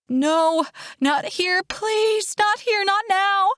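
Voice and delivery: high, pained begging; desperate, high-pitched protest; panicked pleading. A narrator's reading. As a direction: plead through a contraction. Contraction hits during the emergency.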